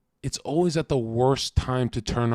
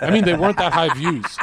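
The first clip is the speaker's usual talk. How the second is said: laughing; 3 semitones higher, 8 dB louder than usual. Real, not acted. Laughing while ranting.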